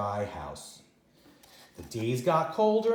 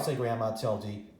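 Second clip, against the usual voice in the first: usual speaking voice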